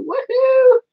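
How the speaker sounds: high-pitched gleeful whoop; celebratory whoop. Real, not acted. Streamer celebrating her milestone, celebratory whoop. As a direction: let out a joyful cheer.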